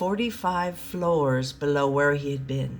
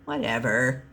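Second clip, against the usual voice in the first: dismissive; 5 semitones lower than usual. Acted. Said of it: "he dismissed her".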